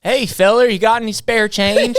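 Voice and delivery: twang it out like a homeless Southern drifter asking for change. Shown as a demonstration. Friend asks how a Southern homeless guy sounds, loud Southern twang. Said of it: "a homeless guy in the South".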